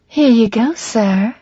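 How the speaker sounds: high, lilting Southern belle; Southern belle accent. A narrator's reading. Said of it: "with my Southern belle accent".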